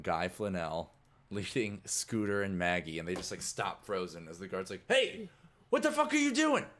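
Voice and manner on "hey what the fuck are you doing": high, loud shout; guard shout. Acted. Guard shouting at the group in the hallway. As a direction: yell at them to stop.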